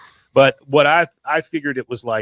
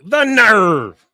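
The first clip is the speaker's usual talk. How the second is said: mock outrage; 10 semitones higher, 8 dB louder than usual. Bill voiced in retold scene.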